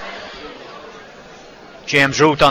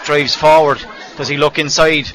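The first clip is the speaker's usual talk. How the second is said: usual speaking voice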